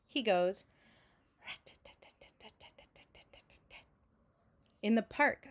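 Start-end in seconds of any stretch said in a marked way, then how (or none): 1.4-3.8 whispered rapid rat-tat rattle; woodpecker rattle. A narrator's reading. Woodpecker like a little hammer, whispered rattle.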